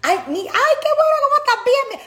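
squealing put-on welcome to surprise guests; fake sweet greeting. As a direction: gush in a falsely delighted high voice.

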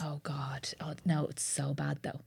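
real whisper of dread. Real, not acted. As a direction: breathe it out, dreading the memory. Dreading telling bad story.

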